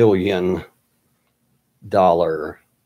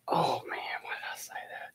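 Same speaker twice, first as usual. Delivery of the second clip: whispered aside to yourself; regretful whisper; 16 dB quieter than usual. Retold or imitated. Kicking yourself after daring the agent to come, retold in a whisper. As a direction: retell a regretful thought, barely audible.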